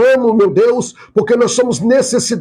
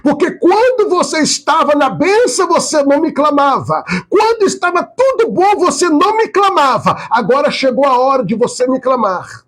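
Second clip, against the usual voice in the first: raised-pitch voice of God; voice of the Lord; 8 semitones higher than usual. Retold or imitated. Preacher voicing God answering people who cry out, pitched high. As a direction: deliver God's reply, rising high. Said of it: "e o Senhor diz".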